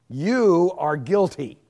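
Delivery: loud, slow and stern; stern verdict. Acted. Preacher condemning the crowd, loud and slow. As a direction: pronounce it like a sentence from the bench. Preachers' sermons that flattened them.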